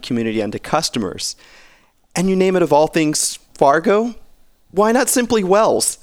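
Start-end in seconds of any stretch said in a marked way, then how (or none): 2.2-6.0 rising disbelief, pitch climbing higher; incredulous. Real, not acted. Co-host mocks the bank naming its app, incredulous. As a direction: ask it as if the name is absurd. Mocking the odd product name.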